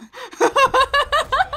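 loud, high cackle of excitement; shrieking giggle fit; excited laugh. Real, not acted. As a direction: burst into high, giddy laughter. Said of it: "I'm a little bit excited".

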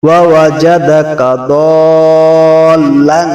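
দাল্লান with a four-count drawn vowel; long madd. Shown as a demonstration. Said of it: "চার আলিফ টানের যে চিহ্ন".

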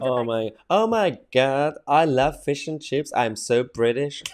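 slow, drawn-out Californian gushing; exaggerated slow California-speak; mock California accent. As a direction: mimic her with a Californian lilt. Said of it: "Why do I sound like I'm from California?".

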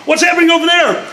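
impatient player pushing for action; quick mock eager adventurer asking.

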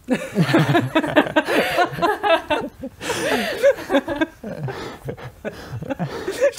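bursting into high, ringing laughter. Real, not acted. Host breaks into laughter. (lautes Lachen)

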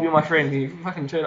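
normal talking voice

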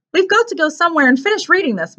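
high-voiced earnest boy; serious. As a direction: urge it earnestly. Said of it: "Mark said seriously".

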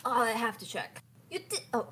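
usual speaking voice

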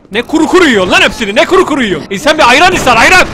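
loud, rising, indignant nagging; mock scolding. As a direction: scold in mock outrage, building on the repeat. Scolding character eating without drink.

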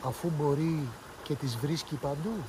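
put-on quiet wondering girl, slowed down; thoughtful. A narrator's reading. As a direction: muse it aloud, slowly. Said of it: "είπε σκεπτική".

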